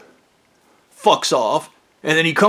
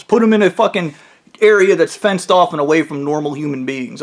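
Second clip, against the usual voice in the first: usual speaking voice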